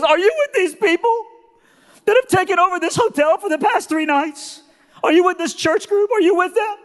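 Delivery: very high, loud, worked-up woman's voice; high-pitched imitation of a distraught lady; tearful cleaning lady. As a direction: squeal it as an overwrought hotel cleaner. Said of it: "We just noticed you were crying".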